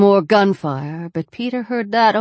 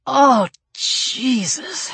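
pained cry; 6 semitones higher, much slower than usual. A narrator's reading. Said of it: "and Peter screamed".

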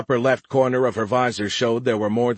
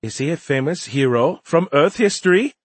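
high, louder rising question; curious young alien; 7 semitones higher, 5 dB louder than usual. A narrator's reading. Alien asking about an Earth figure, curious high voice. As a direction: ask with earnest alien curiosity.

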